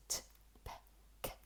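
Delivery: "t, p, k" as bare puffs without vowels; short voiceless bursts for "t, p, k"; isolated consonant sounds. Shown as a demonstration. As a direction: say "t, p, k" as sounds, not letter names. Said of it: "how they pronounce in isolation".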